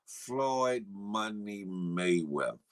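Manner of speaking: "Floyd Money Mayweather" dropped very low and slow; deep drawn-out name. Real, not acted. Hyping the name, deeper second take.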